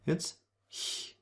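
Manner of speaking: breathy ich sound, hissed at the palate; soft h-like ch. Shown as a demonstration. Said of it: "after the vowels".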